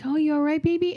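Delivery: put-on high, cooing baby-talk question; soothing parent. Parent voiced to crying child.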